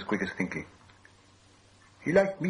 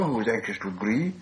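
ordinary delivery, nothing marked

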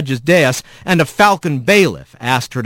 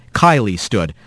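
plain reading voice

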